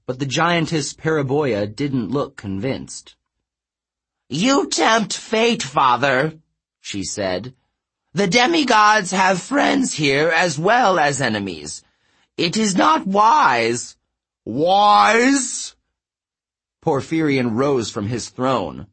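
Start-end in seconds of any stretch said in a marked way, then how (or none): 4.3-6.4 high, slow warning to her father; higher, reedy giantess. A narrator's reading. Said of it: "Her voice was higher and reedier". 8.1-14.0 high, loud objection to a father; higher, reedy giantess. A narrator's reading. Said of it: "Her voice was higher and reedier". 14.4-15.8 loud, high, rising incredulous question; outraged echo. Acted. King affronted by daughter's challenge.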